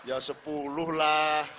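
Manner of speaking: loud, slow, drawn-out haggling; cheeky bargaining. Acted. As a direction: stretch it out like a market haggler. Fisherman bargaining with God. (menawar dengan nakal, keras dan lambat)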